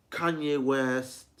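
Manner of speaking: usual speaking voice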